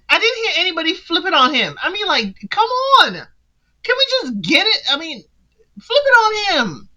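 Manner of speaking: high, fast exasperated plea. Real, not acted. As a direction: beg the show to turn it on him. Exasperated at dating show.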